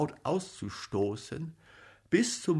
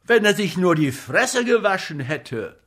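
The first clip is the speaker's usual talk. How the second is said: loud, higher-pitched grumbling rebuke; indignant, disgusted scolding; 6 semitones higher, 9 dB louder than usual. A narrator's reading. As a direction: complain about him in a raised voice. Comic keeps scolding the silent actor.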